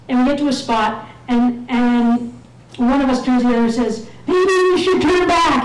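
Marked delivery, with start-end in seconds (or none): 4.3-5.6 high-pitched mock yell; imitated screaming. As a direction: pretend to yell over the wind. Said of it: "we're screaming at each other".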